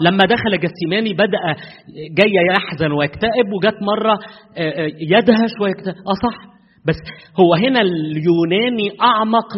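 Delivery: ordinary talk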